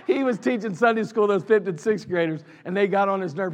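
ordinary talk

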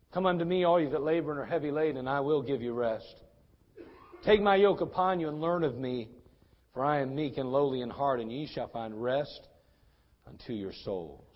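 slowing into a hushed close; gentle scripture recitation. Acted. Recites Jesus' invitation.

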